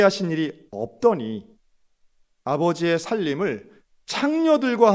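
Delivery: loud, harsh protest to his father; the elder son finally yelling his grievance; angry outburst. Acted. Elder son shouting at his father in anger. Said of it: "큰 목소리로 거칠게".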